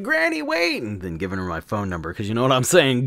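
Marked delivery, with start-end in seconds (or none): 0.0-0.8 very high mock call; hammed-up cry to stop her; calling after her. Man calling after the departing granny, mock-eager. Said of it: "been like".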